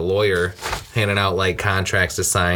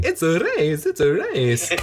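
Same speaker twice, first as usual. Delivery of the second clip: mock Mr. Bean; 7 semitones higher, slower than usual. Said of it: "running through the hotel saying".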